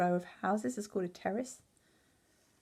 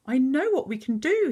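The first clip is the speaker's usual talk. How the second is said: higher, louder burst of inspiration; bright idea; 7 semitones higher, 5 dB louder than usual. A narrator's reading. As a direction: announce a sudden brainwave eagerly. Father announces his bright idea.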